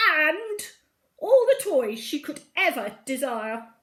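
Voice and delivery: loud, grand royal offer; wing commander. A narrator's reading. Wing commander bribing Emily Brown, loud and grand. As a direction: list the gifts like a proud herald. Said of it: "The wing commander saluted and said".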